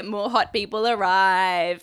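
ordinary talk, nothing marked

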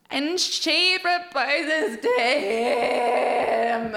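slow swooning gush over the proposal; drawn-out gushing. Acted.

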